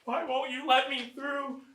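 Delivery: high, loud begging, close to crying; desperate pleading. Acted. Trapped man begging the crowd to let him through, desperate. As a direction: build from a plea to frantic shouts.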